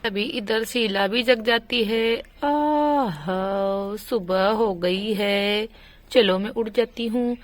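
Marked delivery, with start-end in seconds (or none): 2.4-4.0 slow drawn-out lower waking "aaha"; waking stretch sigh. Acted. Sheela stretches awake, a slow lower waking sigh.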